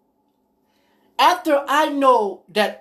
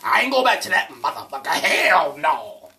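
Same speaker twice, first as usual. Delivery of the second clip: mock refusal; 8 semitones lower than usual. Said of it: "a man or woman will say".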